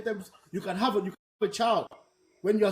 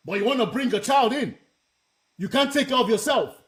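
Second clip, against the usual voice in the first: raised, heated telling-off of a friend; retold angry scolding; 5 semitones higher, 4 dB louder than usual. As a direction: scold her, voice up, still fired up. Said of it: "I was pissed".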